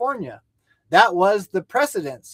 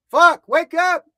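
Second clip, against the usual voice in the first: higher, louder cry of frustration; real exasperation, raised pitch; exasperated shout; 8 semitones higher, 6 dB louder, slower than usual. Real, not acted. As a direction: yell at them to wake up. Berating supporters of mainstream media.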